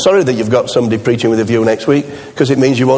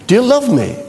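higher, pressing "love"; insistent repeat; 9 semitones higher than usual. Retold or imitated. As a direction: ask again, insisting. Tevye repeats the question.

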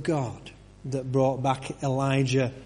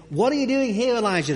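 higher, raised question; the voice calling to Elijah, pitched up; voice of God; 7 semitones higher, faster than usual. Acted. The voice speaks to Elijah.

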